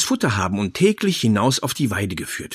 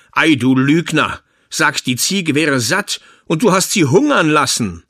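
louder, scolding tailor; angry father; 4 semitones higher, 6 dB louder than usual. Acted. The tailor scolds his son for lying, angrily. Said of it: "in seinem Zorne".

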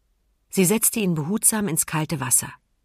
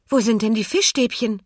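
erstaunter, höherer Ausruf; rufen; 6 semitones higher, 4 dB louder than usual. A narrator's reading. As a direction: ruf die Frage hoch hinaus. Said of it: "rief Emma". (verwundert gerufen)

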